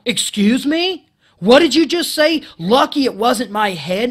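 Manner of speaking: echoing the coworker's remark back in loud outrage; indignant outburst. Retold or imitated. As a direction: make a scene of it, incredulous. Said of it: "I made a fucking scene".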